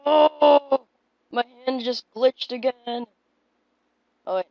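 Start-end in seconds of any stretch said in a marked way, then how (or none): 0.1-0.9 loud high "oh" scream; sudden high yelp of dismay; startled yell. Real, not acted. Cries out at glitch. 1.3-3.1 loud, high-pitched gamer complaint; loud frustrated whine; exasperated complaint. Real, not acted. Complaining about a glitch.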